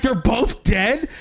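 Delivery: high, stretched shriek of disbelief; retold screaming. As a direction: cry it out high in shock, retelling a panic. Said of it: "like, screaming".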